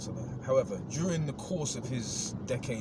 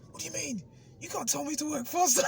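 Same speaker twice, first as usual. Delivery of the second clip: mock coworker. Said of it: "he's like".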